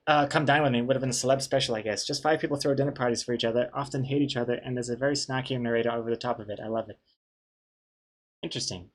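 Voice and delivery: usual speaking voice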